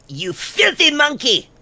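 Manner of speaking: high, slow sneering taunt; Falco taunt. Retold or imitated. Quoting Falco insulting Fox, sneering high. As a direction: sneer it like a cocky pilot. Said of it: "It's like".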